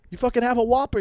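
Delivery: normal talking voice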